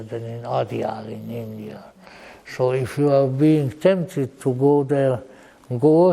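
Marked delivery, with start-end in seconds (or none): 0.0-1.8 hushed whispered aside; whispering. Real, not acted. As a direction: drop to a breathy whisper, as if sharing a secret. Whispered aside about Adyar.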